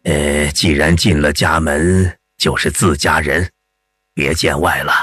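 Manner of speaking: unhurried elder putting a patient at ease; old man. Acted. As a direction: play a reassuring old village healer. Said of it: "老人边为一时敷草药边说".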